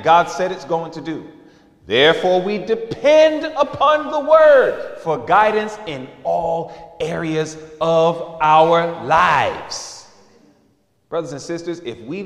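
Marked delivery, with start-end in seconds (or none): 1.9-10.1 slow preaching build. Acted. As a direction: stretch every word of the point. Preaching climax, slow and high.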